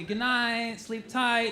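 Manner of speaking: sinister lilting good night; ominous reading of a text. Retold or imitated. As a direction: read it like a threat. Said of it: "she was texting me".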